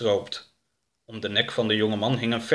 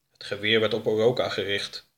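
plain reading voice

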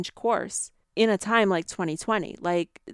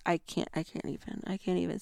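near-breathless, much softer trailing off; exasperated mutter; 9 dB quieter than usual. Real, not acted. Fed up with people who trust the government, muttering softly. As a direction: give up mid-sentence under your breath.

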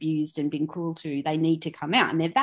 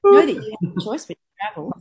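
airline announcement; 2 semitones higher, 8 dB louder than usual. Pretend. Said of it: "mock airline announcement".

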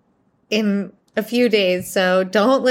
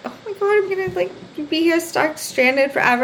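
high-pitched mock panic; retold panic; 8 semitones higher than usual. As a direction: act out a stranded panic, voice starting way up. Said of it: "I'm, like, panicking".

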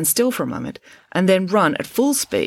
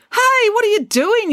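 high, loud, slow startled shout; alarmed yell; 6 dB louder than usual. A narrator's reading. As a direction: yell it as you leap away. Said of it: "yelled Ian".

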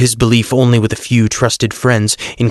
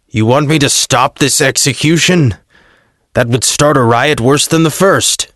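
lightly voiced gruff governor, a little higher; scornful Pilate; 4 semitones higher than usual. Pilate to Joseph, bored and scornful governor voice. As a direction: sneer at the politician, impatient.

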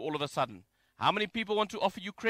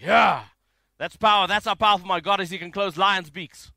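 loud, fired-up boast; triumphant cheering; 8 dB louder than usual. Acted. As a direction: cheer it out like a thrilled believer. Acts out cheering at story.

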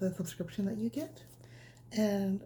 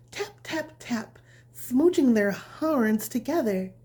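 bedtime story reading; 4 semitones higher, 8 dB louder, slower than usual. A narrator's reading. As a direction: read it gently like tucking a child in. Reads bedtime story to children.

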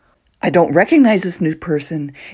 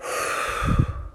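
long audible out-breath; deep sigh; 7 dB quieter than usual. Shown as a demonstration. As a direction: breathe out slowly and deeply. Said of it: "take a deep breath".

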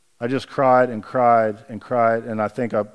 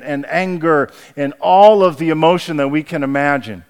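higher, louder sermon voice, slowing on key words; impassioned preaching; 3 semitones higher, 4 dB louder than usual. Acted. Pastor urging his friends in the pews, impassioned preaching. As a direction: build the sermon to full force.

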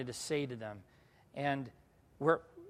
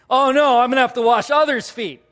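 loud, higher-pitched mock panic; mock dread; 6 semitones higher, 11 dB louder than usual. Church speaker replays own alarm at having to wash feet, mock dread. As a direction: exaggerate the horrified realization, loudly.

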